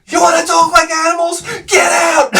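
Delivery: high, loud furious shout; angry father. Retold or imitated. Father throwing the cursing boys out, furious shout. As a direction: throw them out with a yell. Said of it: "And he was like".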